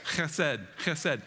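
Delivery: "Chesed" with a throaty ch; a calm guttural "Chesed"; Hebrew chesed. Shown as a demonstration. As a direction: scrape the ch of "Chesed" lightly in the throat. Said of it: "you gotta kinda say chesed".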